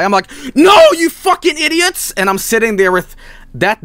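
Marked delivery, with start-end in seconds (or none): 0.6-2.1 high, loud exasperated shout; frustrated kid yell. Retold or imitated. Frustrated kid yelling at parents.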